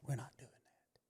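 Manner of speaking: hushed, unvoiced whisper; imitating a teacher whispering a secret. Teacher whispering reassurance to a parent, hushed whisper. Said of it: "Why you gotta whisper that?".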